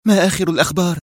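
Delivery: put-on a young woman pressing for news; Mona asking quickly. A narrator's reading. Mona urgently asks Hussam for the latest news, eager. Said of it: "وسألته منى بسرعة".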